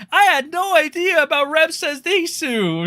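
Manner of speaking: sarcastic falsetto-ish mimicry; mocking Nux Taku. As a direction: parrot his fake innocence, high and nasal-free. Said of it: "Nux Taku when it's like".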